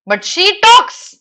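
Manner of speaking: teacher's puzzled emphasis on "talks"; stress on talks. Shown as a demonstration. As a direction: lean hard on "talks" like a teacher. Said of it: "But why she talks?".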